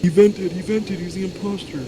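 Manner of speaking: loud high gleeful accusation; excited high call-out. Real, not acted. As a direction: shout it like a game joke. Jokes about Among Us imposter.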